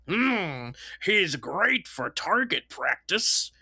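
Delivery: slow sneering cartoon henchman; evil minion voice. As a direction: drawl it like a villain's lackey. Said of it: "very good My Little Pony evil minion voice".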